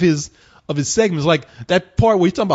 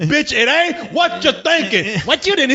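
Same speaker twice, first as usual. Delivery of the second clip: imitating preacher; 8 semitones higher, slower than usual. Preacher arguing with a caller.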